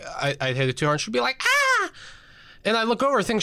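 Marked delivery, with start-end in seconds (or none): 1.4-1.9 very high short yelp; mock scream. Grandmother in the car, a high mock yelp. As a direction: squeal as the brakes hit. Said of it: "she'd start to scream".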